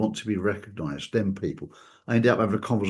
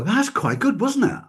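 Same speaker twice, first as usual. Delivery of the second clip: higher, pleased with himself; self-satisfied boast; 11 semitones higher than usual. Retold or imitated. The deluded therapist praising his own bad attempt, smug. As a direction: pat yourself on the back for a bad try. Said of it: "And he'll go".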